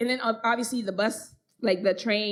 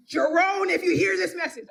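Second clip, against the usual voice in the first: high, loud appeal to an absent man; mock public call-out; 6 semitones higher, 4 dB louder than usual. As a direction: call him out by name, playfully. Mock address to the man, by name.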